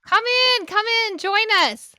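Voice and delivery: high, bright welcome calling him in; host calls the husband in, high and excited. Real, not acted. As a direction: eagerly wave him into the room.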